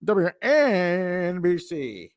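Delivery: high, slow station ident, drawn out; slow, high radio call sign; radio jingle call sign. Acted.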